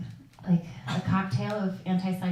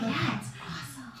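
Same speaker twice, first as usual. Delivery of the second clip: gentle humoring; 5 semitones higher, 10 dB quieter than usual. Retold or imitated. Humoring confused dad gently.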